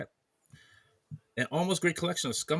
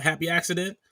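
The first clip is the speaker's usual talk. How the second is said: normal talking voice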